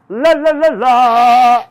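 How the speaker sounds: high, loud magic trill; Miss Dill Pickle. Acted. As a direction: cast a spell in loud "la-la" cries. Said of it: "She went".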